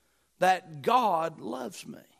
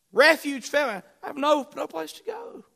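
despairing cry; 9 semitones higher, 4 dB louder than usual. Acted. Preacher voices David's despair.

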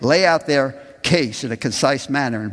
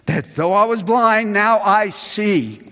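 higher, louder, slow now I see; emphatic testimony; 8 semitones higher, 5 dB louder, slower than usual. Acted. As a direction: lift it up high. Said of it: "I love verse twenty-five".

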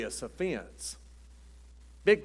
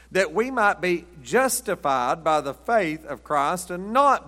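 solemn preacher reading scripture, louder toward the climax; 2 semitones higher, 4 dB louder than usual. Acted. Reads scripture aloud.

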